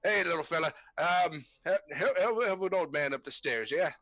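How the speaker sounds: high, loud put-on old-man plea; hammed-up creaky old man; wheedling old man. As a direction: coax a kid into helping you upstairs. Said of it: "he goes".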